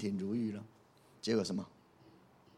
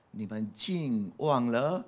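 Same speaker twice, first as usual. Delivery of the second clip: close to tears; much slower than usual. Acted. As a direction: draw it out sorrowfully and let it drop away. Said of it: "讲到这句话就想哭啊". (想哭般缓慢哀叹)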